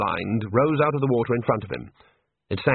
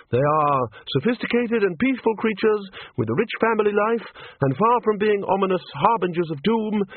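higher-pitched fussy lecture; pedantic academic; 7 semitones higher than usual. A narrator's reading. Chair lectures wizards on harmless sharks, pedantic high voice. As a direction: lecture confidently, then falter at the end.

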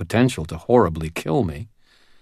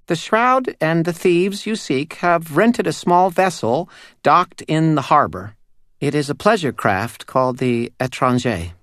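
high, tiny, eerie doll voice; a small uncanny doll spirit speaking; 9 semitones higher, 3 dB louder than usual. Acted. Doll reveals the thieves.

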